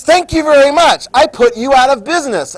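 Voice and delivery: loud, high hammed-up thanks; mock brazen thanks. Government leaving the robbed store, loud mock thanks. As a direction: crow it loudly as the play-acted bully. Government takes goods without paying.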